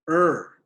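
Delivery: "er" as one slow, drawn-out sound; isolated sound. Shown as a demonstration. Teacher showing the second syllable's sound, drawn-out "er". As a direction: sound out "er" by itself, slowly. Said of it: "the er sound".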